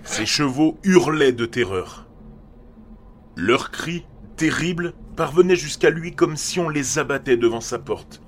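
building intensity. A narrator's reading. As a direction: build up to terror. Horror climax, rising intensity.

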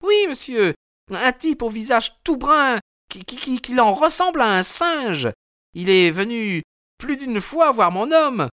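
high, fretful woman's voice; a wife anxious about her missing husband; worried landlady. A narrator's reading. Mrs Smith voiced, anxious. (femme inquiète, voix aiguë)

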